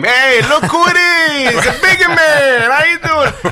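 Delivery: high, loud pizza-man greeting; imitating his father. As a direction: greet the customer like his dad did. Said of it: "watch him, uh, get away with".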